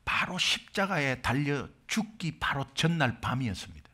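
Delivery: hushed preaching. Acted. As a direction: draw them in quietly. (조용히 낮춰 전하는 설교 목소리)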